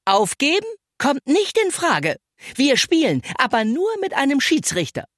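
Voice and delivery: put-on a fed-up girl refusing to give up; getting angry. A narrator's reading. Bille to the boys, refusing to quit, angry. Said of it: "Bille wurde langsam richtig sauer".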